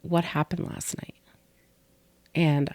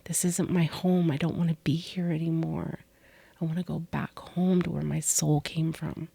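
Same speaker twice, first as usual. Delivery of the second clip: quiet, hushed begging, partly whispered; recalling begging to go home, hushed; soft pleading; 5 dB quieter than usual. Retold or imitated. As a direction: plead softly, almost gone. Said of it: "I was like".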